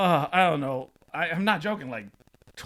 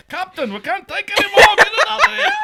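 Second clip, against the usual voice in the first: very high, loud crewman's distress call; panicked mock yell; 14 dB louder than usual. Overwhelmed stomach crew to the captain, panicked mock yell. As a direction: holler up to the captain in comic panic.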